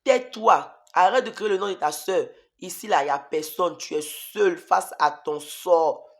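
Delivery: loud devil's command, slowing at the end; devil's shout. Retold or imitated. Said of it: "la voix du diable qui me disait".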